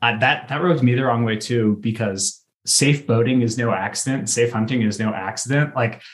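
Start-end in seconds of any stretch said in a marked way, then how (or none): none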